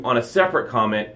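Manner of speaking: usual speaking voice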